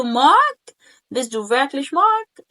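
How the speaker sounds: loud, high call of مارك, then rising question; surprised tone. Shown as a demonstration. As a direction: play up the tune of disbelief. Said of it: "التون ده بيلعبوا عليه". (سؤال بنبرة مندهشة)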